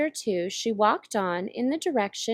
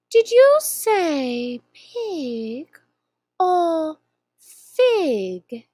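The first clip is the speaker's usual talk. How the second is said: slow, purring Cheshire Cat voice; slow drawling cat; 6 semitones higher, 7 dB louder, much slower than usual. Acted. Grinning cat teasing the lost girl, slow and drawn out. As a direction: stretch each word like a lazy cat.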